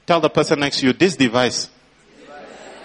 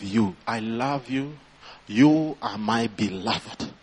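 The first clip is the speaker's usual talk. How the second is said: tender voice of God; 3 semitones lower than usual. Acted. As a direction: speak it like a whispered promise. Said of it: "I really sense the Lord saying".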